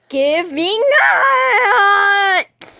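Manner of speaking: slow, high, loud whiny cry; wailing demand. Acted. Child wailing at mom for nuts, slow high tantrum. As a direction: drag it out like a tantrum.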